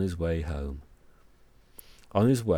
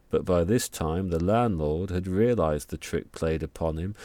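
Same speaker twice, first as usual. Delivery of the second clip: ordinary delivery, nothing marked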